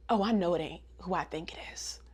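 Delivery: hushed, whispered indignation; disbelieving whisper. Retold or imitated. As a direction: whisper it as if catching someone out. Acting Hana spotting Agatha.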